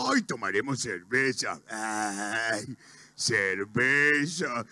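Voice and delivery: low, soft drooling cartoon husband; Homero. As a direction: sink low and savour it slowly. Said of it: "Homero llega y dice". (voz grave y babosa, saboreando despacio)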